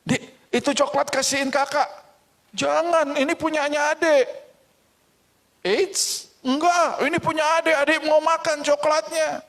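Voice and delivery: put-on high, squeaky kids bickering; childish squabble in a high voice. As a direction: play the quarrelling siblings. Children quarrel over chocolate.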